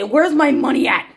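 ordinary talk

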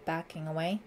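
plain reading voice

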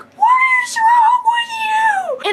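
high, loud mock yell out the car window; hammed-up road-rage scream at a learner driver; mock angry shout. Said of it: "girl in the car behind me who was just like".

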